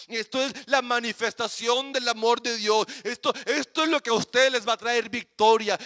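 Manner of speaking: ordinary talk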